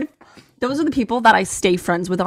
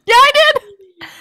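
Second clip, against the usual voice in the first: high, slow, laughing admission; sheepishly admitting sending the meme, laughing; 9 semitones higher, 6 dB louder, slower than usual. Real, not acted.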